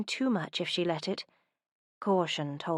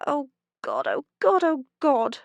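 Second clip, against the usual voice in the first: loud, much higher cries of dismay; anguished cries; 8 semitones higher, 8 dB louder than usual. Acted. Humiliated heroine cries out loudly, much higher. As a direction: cry out over and over in mortification.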